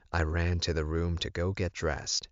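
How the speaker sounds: ordinary delivery, nothing marked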